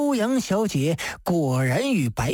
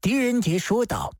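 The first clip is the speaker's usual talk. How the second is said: usual speaking voice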